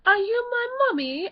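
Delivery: high-pitched eerie child impression; sustained high singsong child voice; creepy gas-mask child. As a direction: ask it like a spooky lost child. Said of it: "And then they're like".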